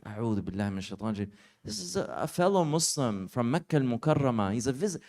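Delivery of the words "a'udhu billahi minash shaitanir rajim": fast exasperated mutter. Retold or imitated.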